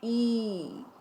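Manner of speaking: sustained "ई" with a falling glide; drawn-out "ई" for the class; long ee. Shown as a demonstration. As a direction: hold the vowel of "ई" long, as a model. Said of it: "इसका उच्चारण भी बड़ा होता है".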